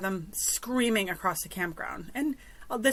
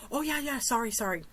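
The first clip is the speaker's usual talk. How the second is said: higher-pitched, offhand imitated apology; breezy mock apology; 4 semitones higher than usual. Noisy campers answering a neighbour's complaint, breezy apology.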